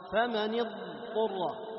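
slow recitation holding the ض in اضطر; ض held flowing. Shown as a demonstration. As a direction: let the ض of اضطر run on. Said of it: "يجري معك". (جريان الصوت في ضاد اضطر)

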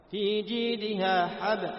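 slow, loud tajweed recitation; Quran recitation. Shown as a demonstration. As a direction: recite slowly with clear qalqala. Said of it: "recitation example".

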